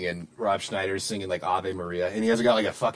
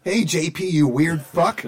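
high rowdy party caller; imitating a rowdy caller, higher and louder; mock voicemail shout; 8 semitones higher, 5 dB louder, slower than usual. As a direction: yell it like a drunk friend on voicemail. Repeating the voicemail quote.

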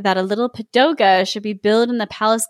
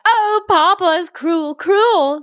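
crying out; 7 semitones higher, slower than usual. Acted. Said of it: "she cried".

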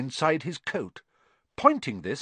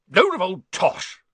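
higher, louder scoffing blast; scornful outburst; 9 semitones higher, 7 dB louder than usual. A narrator's reading. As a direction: spit out the contempt. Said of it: "said Uncle Vernon".

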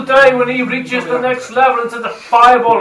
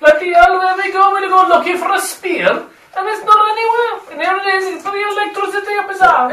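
accidental Welsh lilt, pitched higher; Welsh accent; 9 semitones higher than usual. Acted. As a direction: slip into a Welsh accent without meaning to. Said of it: "he can only do a Welsh accent when he's not trying".

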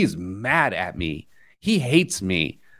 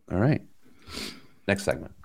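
usual speaking voice

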